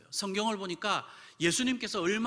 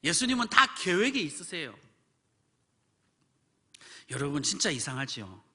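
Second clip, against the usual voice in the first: usual speaking voice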